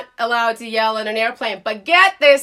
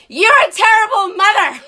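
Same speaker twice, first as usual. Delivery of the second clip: high, loud indignant yell; mock furious yell, half laughing; yelled insult; 8 semitones higher, 6 dB louder than usual. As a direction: throw it at her as an accusation. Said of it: "And you know what I said?".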